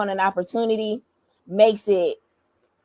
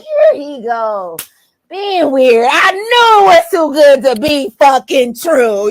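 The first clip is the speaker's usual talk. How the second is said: louder, higher angry outburst near a shout; mad rant; 5 semitones higher, 10 dB louder, slower than usual. Retold or imitated. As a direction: blow up, sure he's ruining it. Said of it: "I'm mad 'cause I'm just like".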